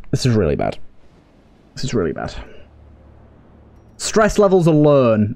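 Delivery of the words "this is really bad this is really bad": much lower, sinking into despair; low despairing mutter. Real, not acted. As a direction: let it drop deep and soft, defeated. Despair after disastrous turn.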